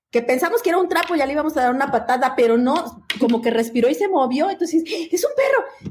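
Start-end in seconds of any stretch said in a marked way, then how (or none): 5.1-5.9 grito agudo de sorpresa; de pronto se da cuenta: es un perro. Retold or imitated. Descubren que el trapo es perro. (sorprendida, mucho más aguda)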